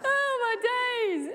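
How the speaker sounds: slow, high, laughing oh my days; giggly, drawn-out exclamation. Real, not acted. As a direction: gasp it out through leftover laughter. Still laughing after joke.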